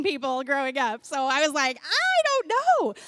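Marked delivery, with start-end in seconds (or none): 1.8-2.9 high, loud, drawn-out mock squeal; hammed-up dread, stretched high and loud; mock panic. Non-dancer faced with moving in worship, mock-panicked squeal. Acts out her fear of dancing.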